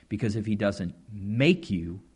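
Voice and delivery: normal talking voice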